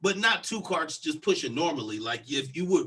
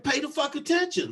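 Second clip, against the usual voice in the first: high-pitched retold scolding; indignant retort; 8 semitones higher than usual. Telling off the careless cart woman, indignant.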